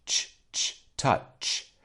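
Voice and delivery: "ch" made with air only; "touch" with a drawn-out airy ch; voiceless final sound. Shown as a demonstration. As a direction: isolate "ch", then end "touch" on it. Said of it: "I'm not using my voice".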